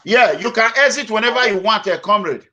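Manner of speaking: to someone hard of hearing. Real, not acted. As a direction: call out loudly so the caller hears. Caller can't be heard.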